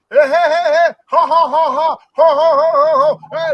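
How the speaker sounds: boisterous mock laughing and backslapping cheer; mock raucous laughter. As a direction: imitate loud, high-pitched locker-room guffaws. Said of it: "was laughing and joking".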